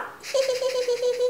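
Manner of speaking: high-pitched burst of laughter; high-pitched giggle. Real, not acted. Laughs at her mask.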